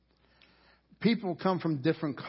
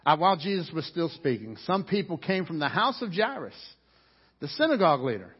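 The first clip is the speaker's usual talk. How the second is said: ordinary talk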